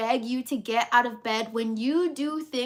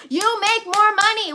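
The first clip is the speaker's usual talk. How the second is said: higher, louder punchline repeat; excited emphasis; 8 semitones higher, 8 dB louder than usual. Real, not acted. Motivator repeating her money point, excited and louder. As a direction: hammer it home.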